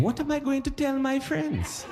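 very high, soft mock fretting; tiny high voice, comic worry; worried inner thought. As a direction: squeak it out as a helpless thought. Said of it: "'Cause he was thinking".

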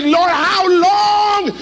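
a preacher's drawn-out shout of how long. Real, not acted. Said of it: "Why are you shouting already".

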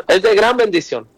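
normal talking voice

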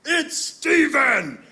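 very high, drawn-out shout; imitated dad hollering. Dad calling his wife to the phone, imitated holler. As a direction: holler through the house, slow and high. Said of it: "And then he goes".

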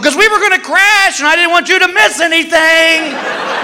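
high, strident mock yell; overwrought excuse. Husband explains waking wife before a crash, high and overwrought. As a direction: wail the justification at top of range.

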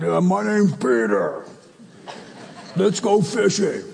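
lightly voiced big goofy Peter; big burly fisherman. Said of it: "I wonder what he sounded like".

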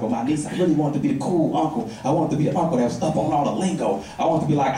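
ordinary talk

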